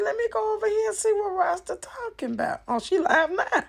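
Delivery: high, put-on voice, eager to see the drama; high excited put-on voice. Vlogger retelling how she rushed to watch Rasta's live, high put-on voice. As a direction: squeal it like you're rushing over to gossip. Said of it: "So I said".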